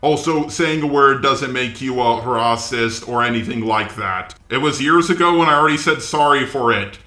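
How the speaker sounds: normal talking voice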